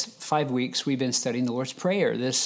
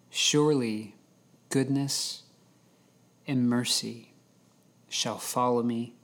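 slow, measured psalm reading with long pauses. Real, not acted. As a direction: read it slowly so each phrase sinks in. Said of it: "I'm gonna read it slowly".

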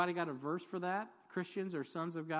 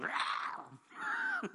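a sudden, much higher roaring "Rah!"; lion roar. Retold or imitated. Preacher roaring as the lion attacking the bear. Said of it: "that lion looks at that bear and goes".

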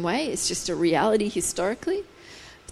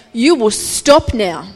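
slow, louder, firm command; stern command; 6 dB louder, slower than usual. Retold or imitated. God speaking to the chaos, slow stern command. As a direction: lay down the law. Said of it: "speaking to the chaos and saying".

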